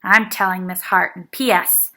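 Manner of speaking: loud sing-song tattle; tattling child. A narrator's reading. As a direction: threaten to tell the teacher, loudly.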